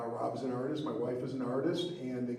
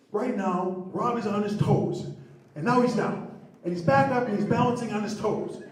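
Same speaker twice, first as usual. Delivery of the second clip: loud, rising play-by-play, higher and louder; excited yelling; 9 semitones higher, 11 dB louder than usual. Retold or imitated. Telling the boss about Rob bobbing behind the wall, loud excited yell. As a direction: call out every bounce like a sportscaster. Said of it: "I'm yelling at him".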